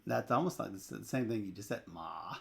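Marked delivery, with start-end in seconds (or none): 1.9-2.4 Ma dipped very low and soft; Ma in a deep low third tone; low dipping tone. Shown as a demonstration. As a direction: sink Ma down low, Mandarin third tone. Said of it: "Low tone".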